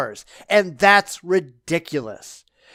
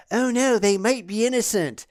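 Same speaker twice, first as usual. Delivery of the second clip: softer, whiny mocking quote; mock whining; 2 semitones higher, 4 dB quieter than usual. Pundit mimicking liberal objections to executions, mock whining. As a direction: imitate a hand-wringing whiner, mockingly. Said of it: "the liberal whining going".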